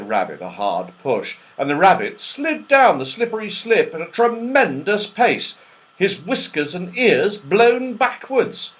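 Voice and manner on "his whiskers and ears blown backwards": ordinary delivery, nothing marked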